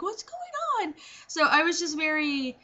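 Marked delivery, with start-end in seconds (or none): 0.0-0.9 high-pitched, baffled question; soft but high, puzzled outburst; confused. Retold or imitated. Patient recalling her bafflement at the nurse's routine, confused.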